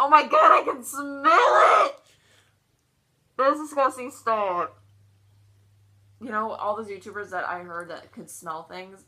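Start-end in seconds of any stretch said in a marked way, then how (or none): 0.0-4.8 loud, drawn-out grossed-out yell; disgusted shout. Real, not acted. Smelling the rotten egg.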